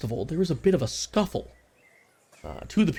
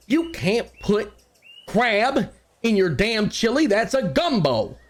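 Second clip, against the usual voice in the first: angry shout; 9 semitones higher, slower than usual. Acted.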